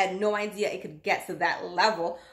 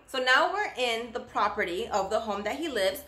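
ordinary talk

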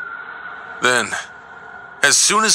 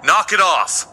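angry shout; 6 semitones higher than usual. Acted. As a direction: call out angrily at the intruder. Yelling at intruder in office.

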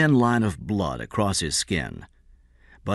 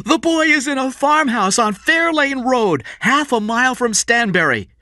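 much higher urgent outcry; shouted; 4 dB louder than usual. A narrator's reading. Kathy shouting the boy's location into the microphone. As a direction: blurt it out to the police. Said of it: "Kathy suddenly shouted into the open microphone".

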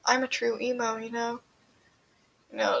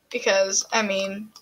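usual speaking voice

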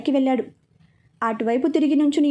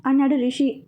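plain reading voice

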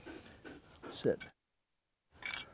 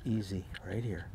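soft calming cue; 2 semitones lower, 12 dB quieter than usual. Shown as a demonstration. Trainer demonstrating to viewers a hushed calming cue. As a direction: show how to settle the dog softly. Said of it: "go like this".

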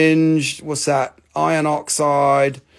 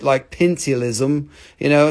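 ordinary delivery

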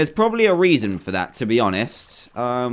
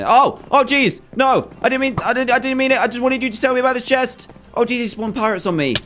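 panicked yelling; 8 semitones higher than usual. Real, not acted. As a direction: blurt it out in real alarm as pirates appear. Captain suddenly attacks him.

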